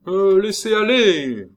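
high, loud, drawn-out order; commanding call from afar. A narrator's reading. Brigadier calling an order from afar, commanding shout. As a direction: call it out long and high across a distance. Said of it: "commanda de loin".

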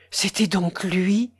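slow, hushed and sorrowful; breath-voiced, slow sadness; sadly. A narrator's reading. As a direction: whisper it in sad realisation. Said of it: "dit tristement la jeune fille pâle et tremblante".

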